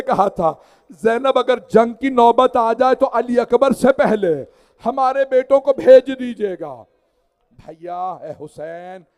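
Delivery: chanted mourning recital, full voice; majlis recitation. Acted. Reciter mourning the martyrs before the congregation, chanted lament.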